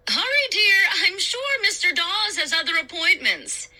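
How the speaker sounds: high, projected mother's call; calling after. A narrator's reading. Mom calling after her child on the stairs, calling out. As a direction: call up the stairs to hurry someone. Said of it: "Mom called after me".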